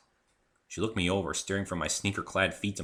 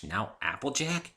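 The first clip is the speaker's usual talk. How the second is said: ordinary delivery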